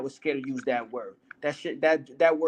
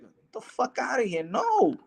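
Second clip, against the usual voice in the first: outraged dismissal; 8 semitones higher than usual. Real, not acted. Outraged rejection of fathers' slang.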